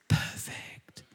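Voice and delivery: a breathless whisper after the list; whispered word. Acted. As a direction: drop to a whisper on the last word. Climax of list, hushed.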